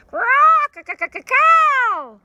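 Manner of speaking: very high, loud, slow squawk; raucous parrot call. Acted. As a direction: greet the morning with a raucous cry. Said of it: "a loud and raucous".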